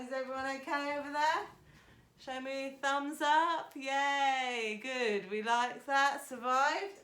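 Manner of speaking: loud, fast then cheery calling to the room. Real, not acted. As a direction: shout across the studio to the class. Calling out to class across room.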